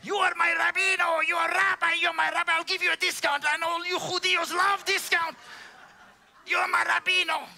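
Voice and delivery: put-on high, loud, laughing Cuban-accented shouts; Miguel impression. [name] imitating Miguel offering a rabbi discount, loud. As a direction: shout it like a jolly Cuban mechanic. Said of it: "It's hard to imitate him".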